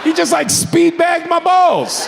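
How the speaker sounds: high, drawn-out comic holler; outraged yell. Retold or imitated. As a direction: holler it in scandalized disbelief. Outraged climax of pat-down story.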